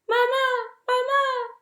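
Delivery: high, loud squeaky hatchling call; tiny gosling cry. Acted. Newborn gosling calling the robot for mama. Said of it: "peeped the gosling".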